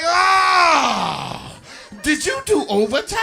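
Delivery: high, loud delighted squeal; overjoyed at the pay stub; mock-excited high shout; thrilled boyfriend. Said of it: "You like".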